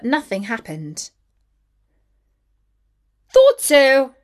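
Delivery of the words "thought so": loud, high gotcha from the wicked queen; triumphant exclamation. A narrator's reading. Wicked queen catches the king out, loud triumphant exclamation. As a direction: crow it like you caught him out. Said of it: "she exclaimed".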